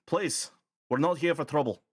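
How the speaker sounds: placating call into the camp; old man calling out. Acted. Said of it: "can't do a goblin voice with a Scottish accent".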